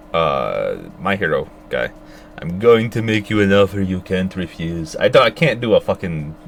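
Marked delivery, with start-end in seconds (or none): 2.4-5.0 a self-admittedly bad Italian-American mob boss accent, lightly done. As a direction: try a gangster film boss accent and fall a bit short. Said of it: "I can't do a mob boss accent".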